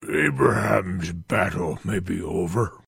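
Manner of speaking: low, scornful fiend sneer. Acted. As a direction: sneer it with contempt. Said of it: "Van sneered".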